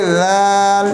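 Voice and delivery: दाल stretched out very long; long, full दाल; lengthened letter. Shown as a demonstration. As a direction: draw out दाल slowly. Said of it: "इसको लंबा भी साथ करना है दाल".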